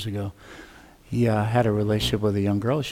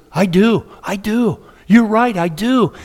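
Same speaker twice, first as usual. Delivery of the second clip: eager agreement; 8 semitones higher, 6 dB louder, slower than usual. Retold or imitated.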